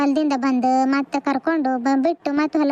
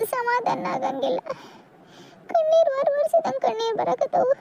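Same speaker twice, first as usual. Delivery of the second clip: high, tearful voice breaking into sobs; crying; 9 semitones higher, 3 dB quieter than usual. Acted. Daughter alone at her mother's door, crying softly. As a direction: weep through the words as the tears keep coming. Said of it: "ಅಳ್ಬಾರ್ದು".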